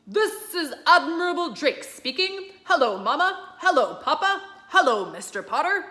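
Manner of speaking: broadcast it from far away; admiral on radio. Acted. Admiral Drake greeting Mister Popper over the radio, announcer-like.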